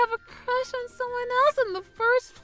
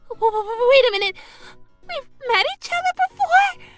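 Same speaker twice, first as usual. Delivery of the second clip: high, loud disbelief; rising, startled outburst; stammering shock; 9 semitones higher, 7 dB louder, faster than usual. Acted. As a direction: stumble into a stunned question. Stunned by mother's revelation.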